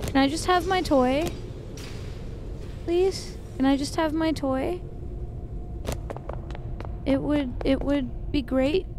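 high, soft wheedling ask; mock pleading. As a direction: sweetly beg for the toy. Pleading with machine for toy.

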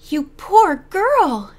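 high, slow, emphatic sympathy; sympathetic. A narrator's reading. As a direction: pour out sympathy, drawing out each word. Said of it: "said Missus Hayes sympathetically".

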